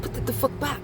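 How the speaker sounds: high, sharp scolding. Pretend. Telling altar meddlers to put it back, scolding. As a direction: scold them like a mother catching a thief.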